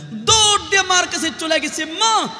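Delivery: sudden high outburst; urgent retold call. Frightened daughter running to her mother, high urgent call. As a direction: jump up high, calling out in alarm.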